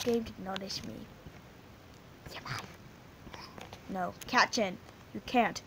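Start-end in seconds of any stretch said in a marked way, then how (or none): 2.3-3.4 whispered much lower and softer; quick whispered bye. Real, not acted. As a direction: breathe it out as a sheepish aside. Quick aside after wish.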